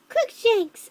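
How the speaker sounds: high, loud shouted spell. A narrator's reading. Girl shouting back at Voldemort, high loud shout. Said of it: "I shouted at him".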